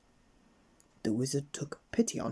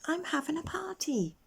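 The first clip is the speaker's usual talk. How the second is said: put-on much higher girlish voice; little girl voice. Miss Fun tells Mister Grumble about her party, high. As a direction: chirp it like an excited little girl.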